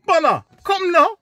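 very high, loud patois summons; angry Jamaican accent. Retold or imitated. The furious flatmate calling him over, high Jamaican patois. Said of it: "He goes to me".

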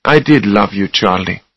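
mildly sad, understated farewell. A narrator's reading. Susanna leaving Charlie at the door, sad. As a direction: say it with restrained sorrow. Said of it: "she said sadly".

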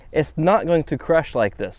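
ordinary delivery

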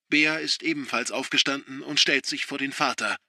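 plain reading voice

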